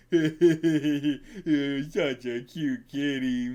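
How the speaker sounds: slow, laughing troll coo; slow laughing troll talking to a kitty. Acted. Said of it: "a troll".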